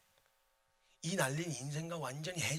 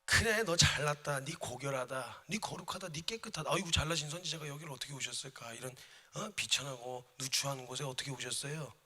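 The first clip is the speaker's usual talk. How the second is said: mocking wife jeering at the prophet; sneering mockery. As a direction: taunt him with mock reverence. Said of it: "이러면서 막 비아냥거리고".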